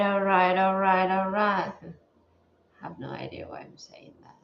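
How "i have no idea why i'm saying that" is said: breathy whisper, quick and low; hushed self-amused aside. Real, not acted. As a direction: mutter it to yourself in a rushed whisper. Self-aside after repeated all right.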